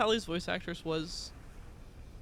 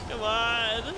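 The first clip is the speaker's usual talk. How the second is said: impatient urging; 4 semitones higher, 6 dB louder than usual. Real, not acted. Urging in game.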